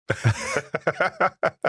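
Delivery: high rising laugh; high giggle. Real, not acted. Laughing at the number fuss.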